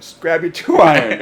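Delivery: loud, high, impatient command; exasperated order. Retold or imitated. Coach putting a boastful kid on the spot, exasperated order.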